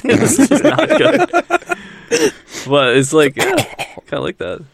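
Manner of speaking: laughing buck-toothed beaver voice; beaver impression. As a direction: lisp it like a goofy beaver. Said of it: "I'm doing my best beaver impression".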